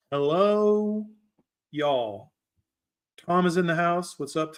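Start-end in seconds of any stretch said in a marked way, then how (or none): 0.1-2.3 a playful put-on Southern accent, slow and drawn out. Streamer greets the chat in a playful put-on Southern accent. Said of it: "Southern accent on".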